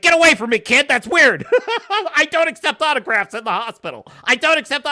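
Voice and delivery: high, loud disdainful brush-off with a laugh; mock snooty actress. Actress shooing off a fan kid, loud and haughty. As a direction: shoo the kid away like a diva. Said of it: "And she's like".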